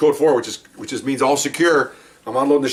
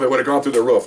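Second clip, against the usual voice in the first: ordinary talk, nothing marked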